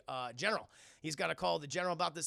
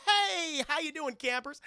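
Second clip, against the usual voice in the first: high, loud fake-cheery hail; overhearty greeting; 10 semitones higher, 6 dB louder than usual. Agent greeting the family after his call, overhearty. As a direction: burst in with a big hello.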